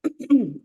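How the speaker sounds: rough throat clear. Real, not acted. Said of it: "my morning voice".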